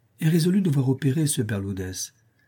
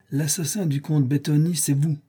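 plain reading voice

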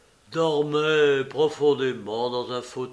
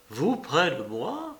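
slow, wondering question; trembling with emotion; 3 semitones higher than usual. A narrator's reading. Patient amazed to find her near him, emotional wonder. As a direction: draw it out in moved disbelief. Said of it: "d'une voix tremblante d'émotion".

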